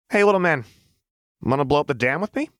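bright, quick, teasing call; playful taunt. A narrator's reading. Jinx teasing Echo into blowing up the dam, playful. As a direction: dare him gleefully to join in.

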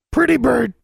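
high, loud bird squawk; talking raven. A narrator's reading. Raven answers its owner's request, squawking bird voice. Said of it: "Charlie said".

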